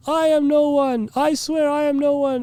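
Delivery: humble-bragging preacher. Acted. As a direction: parody false modesty in a high preacher voice. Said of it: "humble bragging".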